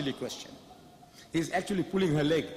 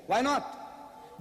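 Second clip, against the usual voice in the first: normal talking voice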